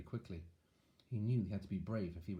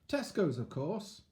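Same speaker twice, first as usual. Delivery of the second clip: joking wise man; 8 semitones higher, 4 dB louder, slower than usual. Acted. Joke line in wise man voice.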